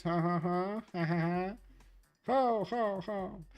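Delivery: high, put-on "ha ha ha" laugh; mock laughter demo. Shown as a demonstration. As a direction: try out a silly fake laugh. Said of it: "Is that American laughing is different".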